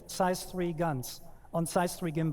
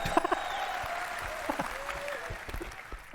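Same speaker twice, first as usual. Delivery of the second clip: high breathy giggle; 5 dB quieter than usual. Real, not acted. Embarrassed after slip.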